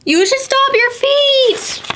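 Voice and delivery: very high, slow animal voice. Acted. An animal telling Jim to stomp, very high. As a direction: squeak it out slowly.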